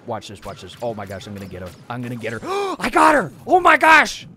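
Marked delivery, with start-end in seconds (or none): 2.8-4.3 loud, high burst of glee after a gasp; excited triumph. Real, not acted. Player just got Meow Meow Bear, excited shout. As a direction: exclaim it high and loud in amazed victory. Scores a kill in game.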